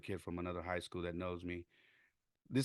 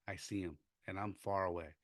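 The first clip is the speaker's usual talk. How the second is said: ordinary delivery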